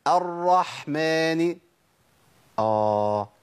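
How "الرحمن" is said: slow chanted الرحمن with breathy mid-throat ح; drawn-out, carefully articulated الرحمن; model clear ح. Shown as a demonstration. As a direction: lead a repeat-after-me الرحمن, holding the ح. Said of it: "جرب بقى كده وقول ورايا".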